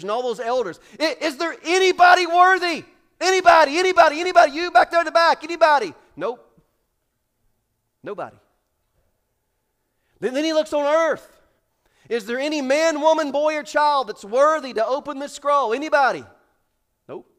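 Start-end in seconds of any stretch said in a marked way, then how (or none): 1.0-5.9 loud, high calling out to the crowd. Acted. Angel calling out for anyone worthy. 12.1-16.3 loud, high calling out to the crowd. Acted. Angel calling on earth.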